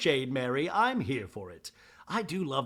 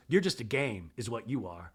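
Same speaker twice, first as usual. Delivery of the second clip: low mock Mary; 6 semitones lower, 4 dB quieter than usual. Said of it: "Mary goes".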